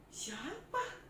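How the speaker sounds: usual speaking voice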